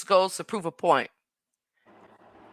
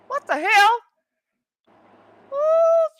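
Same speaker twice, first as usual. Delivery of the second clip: high, loud squeal of disbelief; shocked squeal; 3 dB louder, slower than usual. Real, not acted. Streamer shrieking at a chat comment, shocked.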